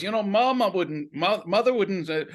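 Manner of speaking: high, loud acted quote; imitated needling son. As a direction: play the son provoking his dad. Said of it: "Kyle MacLachlan was the son".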